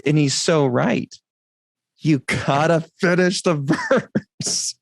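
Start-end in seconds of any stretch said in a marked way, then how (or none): 2.0-4.8 laughing. Real, not acted. Repeats the line, laughing.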